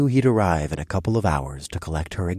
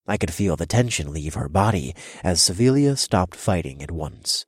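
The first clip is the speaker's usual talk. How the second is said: plain reading voice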